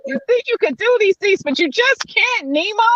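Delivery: high, teasing scold at herself; mock self-scolding. Host teasing herself about overreaching, scolding. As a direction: chide yourself like a fond parent. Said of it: "I always think".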